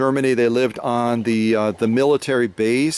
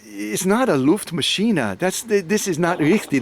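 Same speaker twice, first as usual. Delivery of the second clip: a hint of a kindly correcting shopkeeper, a bit higher; lightly voiced German shopkeeper; 6 semitones higher than usual. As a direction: gently set the foreigner straight.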